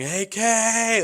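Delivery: high, loud cheer from the stands; mom yelling. Retold or imitated. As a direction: call the nickname out like a cheering mom. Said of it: "I hear my mom yelling".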